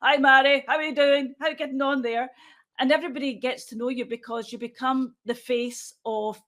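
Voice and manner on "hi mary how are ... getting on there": lightly acted acquaintance, higher voice; chatty mock hello, pitched up; imitated friendly greeting. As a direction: greet an old acquaintance in passing. Acting out acquaintances greeting her in court.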